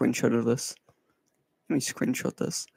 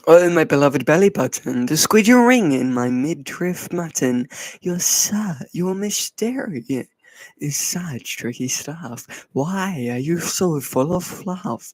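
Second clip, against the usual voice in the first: theatrical verse reading, loud opening; poem recital; 3 semitones higher, 4 dB louder, slower than usual. Acted. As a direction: recite a silly ode with swooping pitch. Reads poem aloud.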